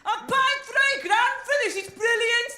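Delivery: high, loud mock enthusiasm; put-on thrilled yell, pitched far up; hammed-up delight. Imagined working-class skier on French mountain, hammed-up delight. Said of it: "top of a snowy mountain in France and hear".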